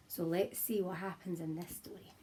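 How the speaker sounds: soft unvoiced whisper, drawing them in. Real, not acted. Teacher drawing children into the story, whispered. Teacher building suspense before reading.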